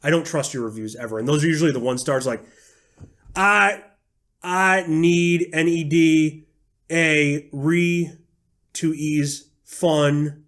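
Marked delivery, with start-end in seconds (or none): 3.3-10.4 slow, word-by-word mock reading; mock bad speller. Mocking a badly spelled one-star review, slowly spelling it out. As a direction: sound out the misspellings slowly and emphatically. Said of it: "people that spell shit wrong".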